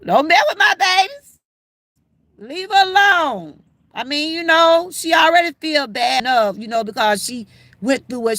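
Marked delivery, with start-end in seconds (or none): 0.0-3.6 protective grandma. Retold or imitated. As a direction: lightly play a grandma guarding her babies. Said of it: "I was grandma".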